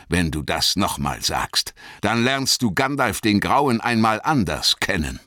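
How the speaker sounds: stern threat, building in force; Gandalf, drohend. A narrator's reading. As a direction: start contained, then raise the voice in warning. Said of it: "Jetzt werde ich gleich böse". (streng drohend, anschwellend)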